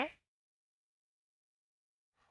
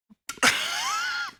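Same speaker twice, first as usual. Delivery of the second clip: sudden high shrieking laugh; loud burst of laughter; 11 dB louder than usual. Real, not acted. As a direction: let a big laugh burst out. Streamer laughs at chat.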